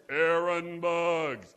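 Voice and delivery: deep, slow, loud divine call; booming voice of God. Retold or imitated. Pastor imagines God calling his name, deep and booming. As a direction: boom the name down like God from the clouds. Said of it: "he would talk like that".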